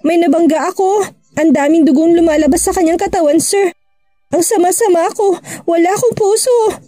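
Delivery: lightly voiced tearful confession; a hint of sobbing guilt, understated; crying. A narrator's reading. Maid confessing to her boss at the station, mildly tearful. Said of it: "cried even more".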